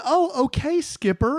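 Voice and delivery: high, playful acknowledgment; lilting mock deference, high; self-quoted retort. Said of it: "I just said".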